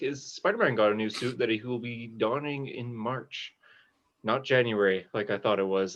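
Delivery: ordinary delivery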